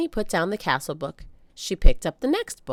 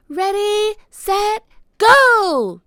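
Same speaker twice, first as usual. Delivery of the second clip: put-on high excited child's shout, growing louder; little girl shouting go into the storm; 11 dB louder, much slower than usual. A narrator's reading. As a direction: yell it like the start of a race. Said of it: "shouted Annie".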